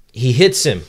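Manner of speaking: loud, punched-out declaration; emphatic announcement. Real, not acted. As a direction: land it as a big payoff. Opens the chapter with the payoff.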